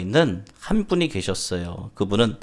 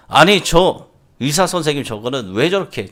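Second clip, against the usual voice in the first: raised, louder grumbling father; angry complaint; 5 semitones higher, 5 dB louder than usual. Acted. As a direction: complain indignantly to the nurse. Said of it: "화를 내면서 막 이렇게 있었습니다". (화난 아버지가 언성 높여 불평하듯)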